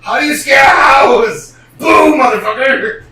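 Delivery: loud, high mock-scary shout; shouting trickster. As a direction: yell it to spook a house. Trickster trying to scare house.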